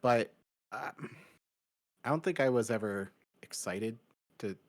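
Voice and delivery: usual speaking voice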